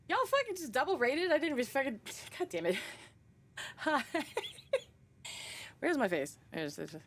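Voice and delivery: ordinary delivery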